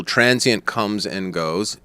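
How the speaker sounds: slow explanation. Shown as a demonstration. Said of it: "temporary amnesia that affects the entire brain".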